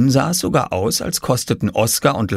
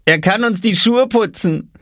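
put-on high boyish voice; teasing brother; 11 semitones higher, 3 dB louder than usual. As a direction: toss it in like a cheeky kid. Brother teases Oskar. (frech, hohe Jungenstimme)

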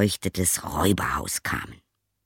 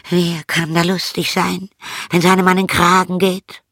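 high, loud cat voice; complaining cat character; 11 semitones higher, 5 dB louder than usual. Acted. Gloomy cat answers the donkey, complaining cat voice. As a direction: lament it high as an old cat.

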